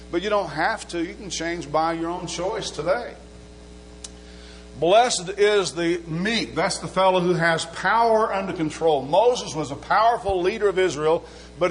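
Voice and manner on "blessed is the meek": 1 slow emphatic. Acted. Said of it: "slow emphatic scripture".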